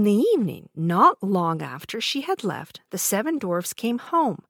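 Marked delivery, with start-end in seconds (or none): none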